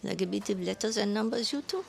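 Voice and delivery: put-on old woman's creaky French accent. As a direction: call out in a heavy French accent. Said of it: "creaky, heavy French accent cried out".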